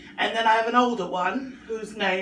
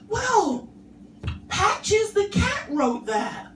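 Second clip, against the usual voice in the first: put-on much higher, slow earnest child; little boy voice; 10 semitones higher, much slower than usual. Son telling his mum the cat wrote it, high and slow. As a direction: blame the cat with total sincerity.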